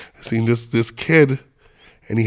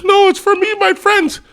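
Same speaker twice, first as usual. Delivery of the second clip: much higher, insistent refusal; high kid voice; 5 dB louder than usual. Retold or imitated. A kid refusing to sell his shirt, high and insistent. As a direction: squeak it out like a stubborn kid.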